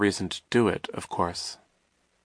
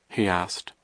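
ordinary delivery

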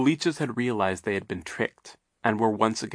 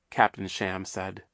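ordinary delivery

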